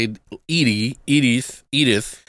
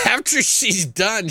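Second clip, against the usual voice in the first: usual speaking voice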